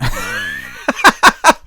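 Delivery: loud laughing outburst. Real, not acted. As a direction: crack up at your own idea. Laughs at own violent idea.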